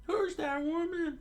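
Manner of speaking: very high falsetto question; high, put-on pirate voice; pirate crewman. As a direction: squeak it out like a cartoon pirate. Pirate's line in the story.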